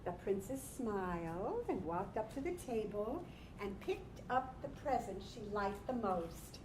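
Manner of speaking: normal talking voice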